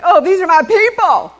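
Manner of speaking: loud, happy cry of recognition; delighted at seeing all the cowboys; delighted exclamation. Retold or imitated. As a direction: greet them like long-lost kin. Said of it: "I'm like".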